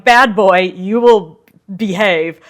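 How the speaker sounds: mock scolding, loud; scolding France. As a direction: scold him like a naughty child. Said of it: "They're like".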